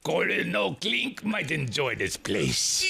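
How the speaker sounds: a light mock German accent, slow; mild German accent, lightly done. Shown as a demonstration. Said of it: "That was my best, uh, accent".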